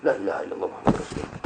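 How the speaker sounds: rushed, breathless whisper of the shahada. Retold or imitated. Rider reciting the shahada at the police raid, fast whisper. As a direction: whisper the prayer fast in mock fright. Retold panicked prayer, whispered.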